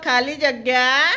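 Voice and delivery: drawn-out, letter by letter with pauses; slow read-out. Shown as a demonstration. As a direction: read the gapped word slowly for a class. Teacher reads out gapped word.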